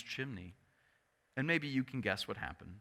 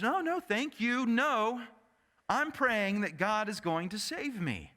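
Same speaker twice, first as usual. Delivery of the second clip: insistent refusal; 6 semitones higher than usual. Retold or imitated. Faithful man refuses rescue, raised pitch.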